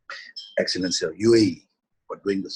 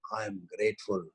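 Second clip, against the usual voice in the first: prayerful invocation; slower than usual. Acted. Teacher leading the group in closing prayer, devotional. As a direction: call on Shiva and Shakti in prayer.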